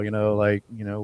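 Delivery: ordinary talk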